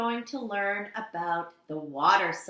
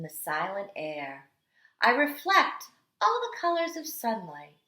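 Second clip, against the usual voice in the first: storytelling read-aloud; 3 dB louder than usual. Acted. Teacher reading The Water Dance to kindergarteners, dramatic storytelling. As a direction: read it like the water itself is dancing. Reads picture book aloud.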